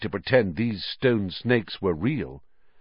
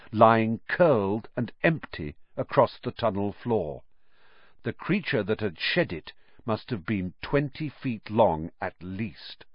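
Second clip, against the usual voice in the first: plain reading voice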